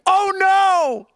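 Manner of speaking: high, loud, drawn-out oh no; mortified at his own blunder; real dismay. Real, not acted. Streamer realizes his gaffe on stream, mortified. Realizes how bad it looks.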